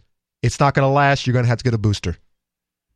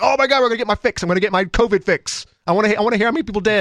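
mock eager junkie; 6 semitones higher, faster than usual. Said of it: "They're like".

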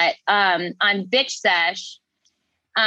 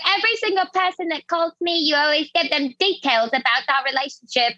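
mocking Dorit; 6 semitones higher, faster than usual. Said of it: "then she's like".

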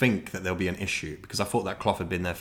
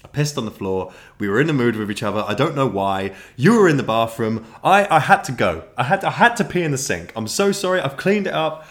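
loud, fast desperate outpouring; mock-frantic boyfriend confessing to his girlfriend; blurted confession; 5 semitones higher, 8 dB louder than usual. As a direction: spill it all at once in a panic.

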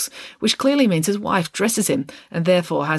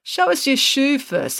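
slow, higher, deliberate command; police chief order; 10 semitones higher, slower than usual. A narrator's reading. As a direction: give the order slowly and firmly.

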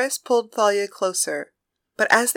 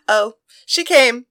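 proud teasing; 3 semitones higher, 5 dB louder than usual. Acted. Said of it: "Thalia added, standing proudly".

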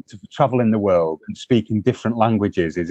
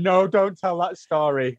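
mock protest; 7 semitones higher, slower than usual. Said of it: "Wendy and Vicky will be like".

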